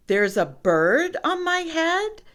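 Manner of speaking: high, loud rising question; startled elephant echoing the news; alarmed disbelief. A narrator's reading. Elephant learns of bird.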